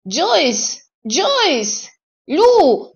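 high, loud yell of names; howling. A narrator's reading. Elmer finds the body and screams for help, howling yell. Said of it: "a urlat".

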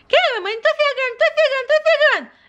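put-on a baby announcing she's coming; baby doll voice. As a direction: call out excitedly like a child running in.

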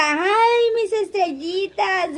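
imitating doña Cuquita squealing over estrellitas, slowly; a laughing, hammed-up old lady's cry; mock shriek. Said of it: "doña Cuquita grita".

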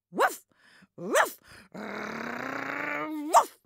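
loud high barks with a growl; loud barking. A narrator's reading. Dog barking hard at the cat on the windowsill. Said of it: "En hij blaft zo hard".

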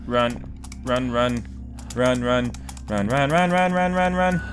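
ordinary delivery

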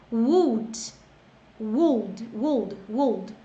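"would" repeated as ud, no l sound; drilling would. Shown as a demonstration. Teacher drilling the word for students. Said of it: "deixa eu só falar aqui".

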